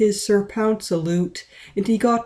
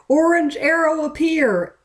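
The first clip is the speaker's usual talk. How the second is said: spell call; 10 semitones higher, 3 dB louder than usual. A narrator's reading. Magical girl spell call.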